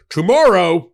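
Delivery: loud, high mock ultimatum; exaggerated, punched-up demand. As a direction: toss out an absurdly urgent deadline. Mimics an unreasonable court deadline.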